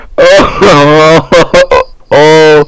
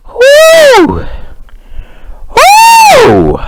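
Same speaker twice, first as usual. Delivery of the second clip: excited whoop. Real, not acted. Cheering after trolling friend offline.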